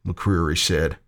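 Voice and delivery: ordinary delivery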